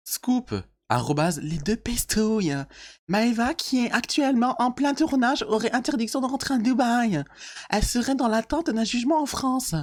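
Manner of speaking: high, far-off mock gossip reader; beauf voice. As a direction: read the gossip like a cheesy bro. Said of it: "je vais reprendre ma voix de beauf".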